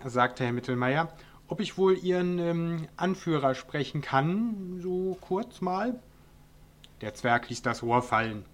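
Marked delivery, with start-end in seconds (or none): 1.5-6.0 higher and slower, hesitant, squeezing the words out; a nervous man asking very politely, stretching the end; timid request. Acted. Mittelmeier nervously asking the dwarf for his boss, timid and slow. Timid neighbour asks for the leader.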